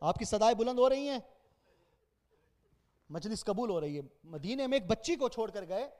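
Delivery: hushed majlis lament; mournful recital. Acted. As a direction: intone the grief quietly, drawing out each name. Soft lament opening the majlis.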